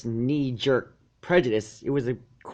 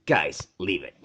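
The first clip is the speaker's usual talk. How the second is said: lower, tired dragon voice; young dragon calming his friends, low; Spike, weary; 7 semitones lower, slower than usual. A narrator's reading. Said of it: "Spike said".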